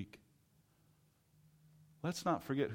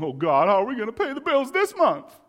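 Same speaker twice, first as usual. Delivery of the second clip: high, loud panicked wail; a listener panicking over money; mock panic; 12 semitones higher, 13 dB louder than usual. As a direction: freak out about the bills. Voicing a listener freaking out.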